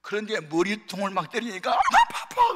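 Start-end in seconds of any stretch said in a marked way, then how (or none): 1.7-2.5 much higher, louder mock yelp of pain; a child yelping as the mother hits him; pained cry. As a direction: cry out like a beaten kid. Child crying out while beaten.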